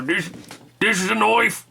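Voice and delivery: a triumphant Aussie reveal with noife; Australian accent. Acted. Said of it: "I can kinda do that Australian accent".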